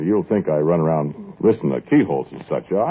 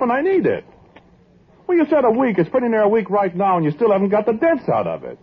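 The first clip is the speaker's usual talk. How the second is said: higher, pushy complaining voice; pestering trumpeter; 14 semitones higher than usual. Acted. Musician badgers the repairman for his trumpet, high and insistent. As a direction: nag the repairman about your horn.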